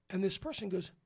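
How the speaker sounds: ordinary delivery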